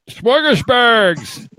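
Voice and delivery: smorgasburgs said slowly as "shmorgashburgs"; mock mispronunciation. Shown as a demonstration. Host teasing a guest over a hard word, mock pronunciation. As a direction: drag out smorgasburgs mockingly. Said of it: "Smorgasburg is a hard".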